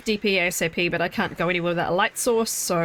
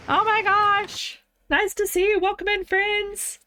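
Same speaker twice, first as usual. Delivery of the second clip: excited high greeting; 11 semitones higher, slower than usual. Real, not acted. Welcoming raiders on stream.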